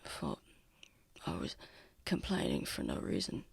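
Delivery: very soft, low murmur; hushed retort. Acted. Injured Lucian throws Tav's teasing back, hushed murmur. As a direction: mutter it low, barely voiced.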